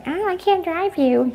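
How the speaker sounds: mocking mom. Teen mocking mom's excuse, high singsong. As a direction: parrot mom in a whiny high voice. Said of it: "my mom's like".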